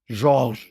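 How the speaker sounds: Georges said the French way, ZHORZH; French pronunciation. Shown as a demonstration. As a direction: say Georges as in French, one syllable. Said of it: "was written was in French".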